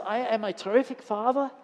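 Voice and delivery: put-on a German businessman in a light accent. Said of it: "forgive my German accent".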